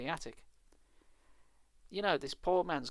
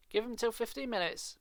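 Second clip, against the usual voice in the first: insisting; 8 semitones higher than usual. Acted. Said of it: "Evan insisted".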